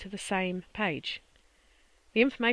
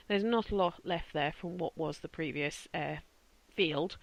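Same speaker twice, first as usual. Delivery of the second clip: ordinary delivery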